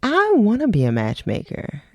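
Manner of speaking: usual speaking voice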